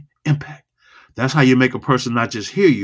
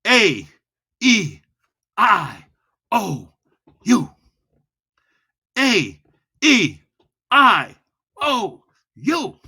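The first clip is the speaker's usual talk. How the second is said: high, separated vowels; slow, loud, high vowel drill; 9 semitones higher, much slower than usual. Shown as a demonstration. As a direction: hold each vowel, one at a time. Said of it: "Loud and slow".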